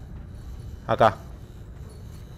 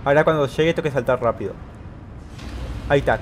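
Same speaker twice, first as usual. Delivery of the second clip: ordinary talk, nothing marked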